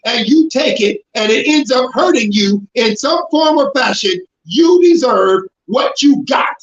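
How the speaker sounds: normal talking voice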